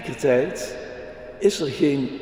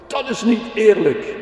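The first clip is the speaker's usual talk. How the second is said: put-on higher and louder child's protest, "niet" hit hard; indignant cry; 9 semitones higher, 5 dB louder than usual. A narrator's reading. Jelle on the back seat protests to the police officer, high indignant cry. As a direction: cry out that it's unfair like an indignant little girl. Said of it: "riep Jelle vanaf de achterbank".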